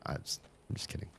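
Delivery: voiceless, fast throwaway; near-whispered quick aside. Real, not acted. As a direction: toss it off under the breath. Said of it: "laughing it off".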